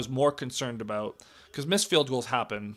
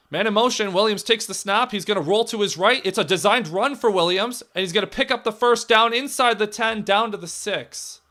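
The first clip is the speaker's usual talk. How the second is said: higher and louder, building play-by-play; excited call; 6 semitones higher, 4 dB louder than usual. Real, not acted. Fan calling the quarterback's run, rising excitement. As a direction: ride the run all the way down the field.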